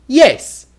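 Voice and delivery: quick, high, loud "Yes"; very fast. Shown as a demonstration. Teacher answering a question as a demo, very fast. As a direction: fire "Yes" out instantly. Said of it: "say things very fast".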